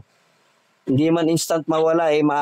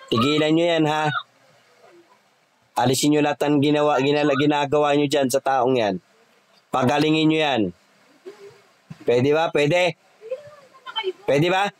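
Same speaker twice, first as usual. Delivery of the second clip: commanding spirits. Acted. Healer commands spirits to leave.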